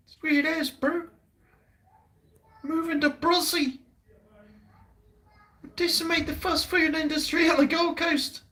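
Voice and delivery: high, put-on New Zealand twang; mock Kiwi accent. Mocking Kiwis after taunts.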